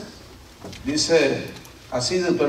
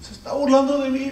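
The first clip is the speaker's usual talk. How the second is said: put-on complaining child; 9 semitones higher than usual. Son complains, crying.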